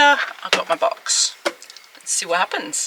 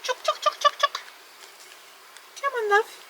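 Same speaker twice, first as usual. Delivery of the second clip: calling chickens; 5 dB quieter than usual. Real, not acted.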